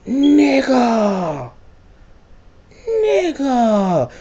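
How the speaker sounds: very high, long falling wail; drawn-out dismay. Retold or imitated. Player reacting to being betrayed, high wail. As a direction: stretch it into a squeaky mock whine.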